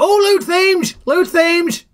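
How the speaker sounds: high-pitched, flustered warning cry; sudden comic panic, pitched right up; mock alarm. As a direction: blurt out a panicked warning, then settle. Sudden panicked content warning interrupting the recap.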